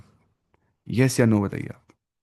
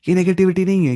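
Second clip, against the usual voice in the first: much higher and louder protest; insistent; 8 semitones higher, 6 dB louder, faster than usual. Real, not acted. Teacher to class, insisting loudly it isn't negativity. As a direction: push back hard on the idea.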